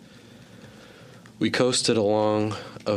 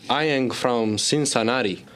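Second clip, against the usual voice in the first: Belgian-Cuban mock accent; 4 semitones higher than usual. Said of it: "fully immersed in our new roles".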